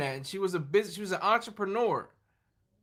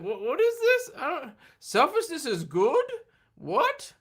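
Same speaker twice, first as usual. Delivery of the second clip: very high, baffled voice; terrible impression of Anis; 6 semitones higher than usual. Imitating a friend shocked at selfishness being good, squeaky. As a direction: play him dumbfounded. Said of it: "I'm doing a terrible impression".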